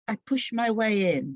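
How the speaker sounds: plain reading voice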